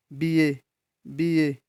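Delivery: बी ए repeated with pauses for listening; isolated, evenly spaced बी ए; sound demonstration. Shown as a demonstration. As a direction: sound out बी ए slowly as separate examples. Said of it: "बी ए में किसकी sound आ रही है".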